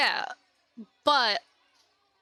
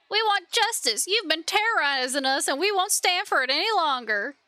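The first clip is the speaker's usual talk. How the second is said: higher-pitched protesting villager; aggrieved villager; 4 semitones higher than usual. Acted. Villager demanding justice from the ruler, in raised-pitch protest. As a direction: confront the ruler with a crowd's grievance. Angry crowd confronts the ruler.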